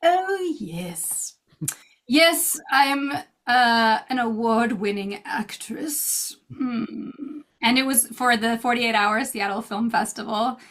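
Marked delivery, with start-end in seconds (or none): 0.0-7.1 mock formal snootiness. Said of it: "put your nose up now and start to speak more formally".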